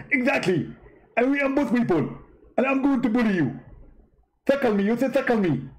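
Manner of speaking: high-pitched mock debater voice; a squeaky, smug rival preacher; Zakir Naik impression. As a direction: push the voice way up and lampoon him. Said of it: "Okay, Zakir".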